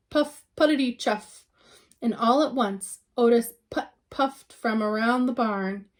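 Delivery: ordinary delivery, nothing marked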